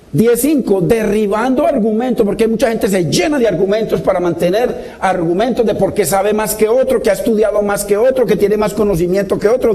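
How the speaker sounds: ordinary talk, nothing marked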